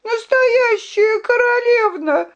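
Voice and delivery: high, sobbing plea. A narrator's reading. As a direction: cry out the words through tears. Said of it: "рыдала Виолинка".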